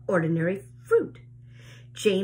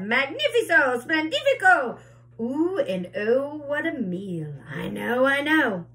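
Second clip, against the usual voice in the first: greedy delight; 6 semitones higher, 3 dB louder than usual. A narrator's reading. Greedy aunt rejoicing.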